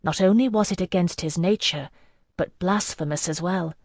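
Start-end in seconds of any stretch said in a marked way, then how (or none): none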